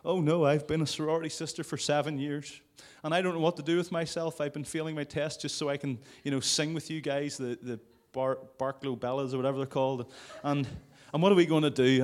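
hammed-up lament of a college singer; mock sorority sister. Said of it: "they're all like".

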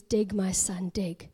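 low, soft divine command; voice of God. Retold or imitated. God tells his son to go on, low and quiet. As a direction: speak as God, hushed and low. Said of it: "the voice comes again".

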